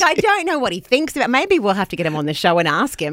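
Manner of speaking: usual speaking voice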